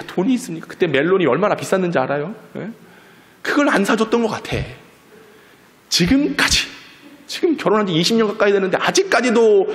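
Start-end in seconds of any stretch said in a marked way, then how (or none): none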